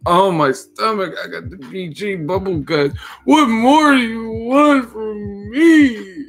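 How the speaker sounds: high, slow mock wail. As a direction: ham up a tearful plea. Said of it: "crying like [name]".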